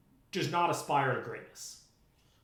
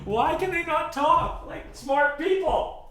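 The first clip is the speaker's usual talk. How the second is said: slow, loud, higher exasperation; exasperated; 10 semitones higher, 7 dB louder, slower than usual. Real, not acted. Griping about questioners, slow and exasperated. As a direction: stretch each word, fed up.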